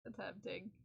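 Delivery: Attempting said soft and dry; stress on Attempting. Real, not acted. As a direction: quietly weigh the word Attempting.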